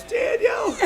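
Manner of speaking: high-pitched hammed-up wail of a name; retold crying shriek. Son imitating mom helplessly laughing at the wheel, mock shriek. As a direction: imitate a laughing-till-crying mother calling out. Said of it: "and she's crying".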